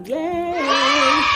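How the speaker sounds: long, loud high-pitched cheer; cheering yay. Shown as a demonstration. Vlogger prompting viewers to cheer, loud drawn-out cheer. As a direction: draw out a whooping yay. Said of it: "Let me hear you say".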